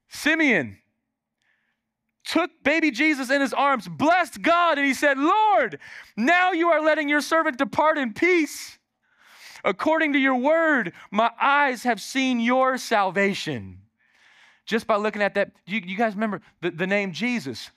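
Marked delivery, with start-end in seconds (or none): 0.1-5.2 high, loud preached reading; fervent sermon proclamation; preaching at full force. Acted. Preacher reading scripture climax. 5.2-13.8 high, loud fervent reading; fervent preacher reading Simeon's blessing; preached scripture. Acted. Preached again with fire.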